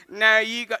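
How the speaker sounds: acted anxious refusal; panicked refusal. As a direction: balk in a flustered panic. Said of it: "We're panicking at the robots".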